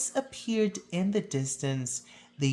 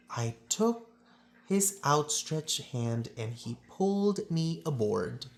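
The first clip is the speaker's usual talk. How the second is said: ordinary delivery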